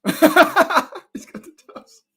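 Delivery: words squeezed out through a high laugh. Real, not acted. Amused by a viewer's Dubs comment, laughing. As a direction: laugh it out slowly.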